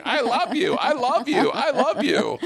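high squeaky toy voice, over and over; repeating toy voice. Retold or imitated. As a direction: mimic the toy that won't stop talking. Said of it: "that kept going".

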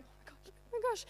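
breathless whispered oh my gosh rising high; excited whisper. Retold or imitated. Son in the back seat whispering, excited. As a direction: whisper it in thrilled disbelief.

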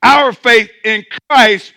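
loud, rising preacher's fervor; preaching. Real, not acted. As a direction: preach it out with full force. Preaching at full force.

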